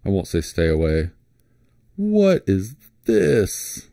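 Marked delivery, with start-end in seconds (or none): 1.9-3.9 very slow, high-pitched bafflement; drawn-out, baffled question; puzzled surprise. Real, not acted. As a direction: stretch each word out in disbelief. Puzzled by a surprising search result.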